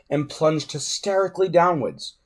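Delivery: ordinary delivery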